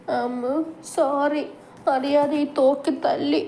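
put-on high, tearful man-child pleading; crying childlike plea. A narrator's reading. Grown man with a child's mind, crying, apologises to his wife. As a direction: beg her not to cry, voice breaking. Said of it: "അവൻ്റെ കരച്ചിലോടുള്ള സംസാരം".

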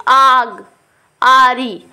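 आग and आरी with a loud, long आ; long aa demo. Shown as a demonstration. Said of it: "आ की sound बड़ी आ रही है". (आग, आरी में लंबा, ज़ोरदार आ)

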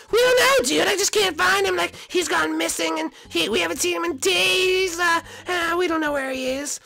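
very high worried voice; squeaky and anxious; Toad voice. Acted.

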